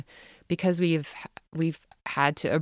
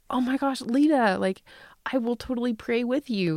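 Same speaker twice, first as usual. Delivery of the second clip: excited mom; 7 semitones higher than usual. Retold or imitated. Mom's excited reply retold.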